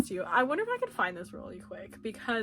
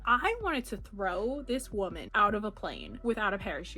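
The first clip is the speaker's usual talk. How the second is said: ordinary talk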